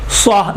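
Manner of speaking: سوا with thick s and rounded vowel; emphatic full-mouthed سوا; heavy saad. Shown as a demonstration. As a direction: round the lips on سوا, "swaa". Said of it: "یہ سوات ہے".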